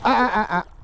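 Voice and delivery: sharp high ah-ah to stop a dog; high warning call. Real, not acted. Stopping the dog mid-run.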